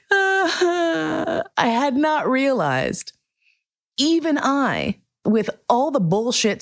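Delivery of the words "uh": high choked sob sound; teary gasp. Retold or imitated. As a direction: let out a high crying whimper. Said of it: "And I started crying".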